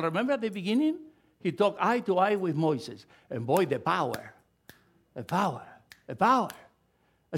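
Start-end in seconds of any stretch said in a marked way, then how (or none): none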